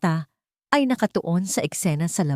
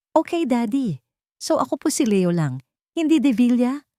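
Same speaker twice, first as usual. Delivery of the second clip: put-on higher, light child voice asking his dad; a small boy checking the rules of the game; child's innocent question, rising at the end; young boy asking; 6 semitones higher than usual. Boy Leo asks his father.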